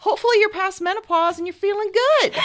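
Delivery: squealy cheerleader voice, way up high; high mock cheer. Friend cheering the host on, in a high mock cheer.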